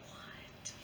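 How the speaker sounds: breathy unvoiced what; whispered mock confusion; whispered aside. Real, not acted. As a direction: hush it out as a puzzled aside.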